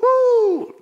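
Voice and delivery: high, loud whoop; celebratory woo; excited whoop. Real, not acted. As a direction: let out a whooping cry. Preacher exclaims about driving.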